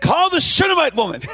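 "Shunammite" savored with a lift; relishing the name. Shown as a demonstration. Pastor enjoying the name before the church, savored. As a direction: lean into "Shunammite woman" with relish. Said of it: "I just like saying Shunammite woman".

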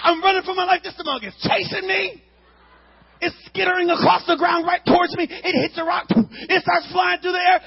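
high, loud panicked telling; panicked shouting. Retold or imitated. Boy running from a firework aimed at his face, panicked shouting. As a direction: shout it like you're still running.

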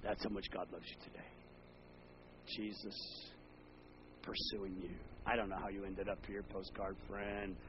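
quiet, tender invitation; hushed appeal. Acted. Pastor quietly telling visitors God loves them. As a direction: speak softly straight to the heart.

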